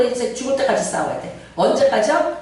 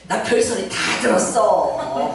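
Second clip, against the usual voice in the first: ordinary delivery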